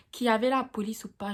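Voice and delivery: normal talking voice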